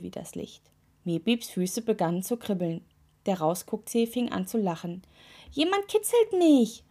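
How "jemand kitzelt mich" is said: high, giggly cry; calling out laughing. A narrator's reading. Toe tickled in the cave, calling out laughing. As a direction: squeal it as if being tickled. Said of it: "rief er lachend".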